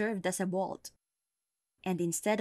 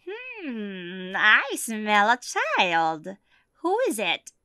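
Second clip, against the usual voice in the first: witch voice; 3 semitones higher, 7 dB louder, much slower than usual. Acted. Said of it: "and cried".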